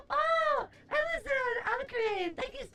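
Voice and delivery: high delighted surprise; suddenly notice the subscriber, delighted; surprised. Real, not acted.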